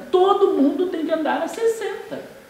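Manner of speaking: mock indignation. Mocking his own demanding self.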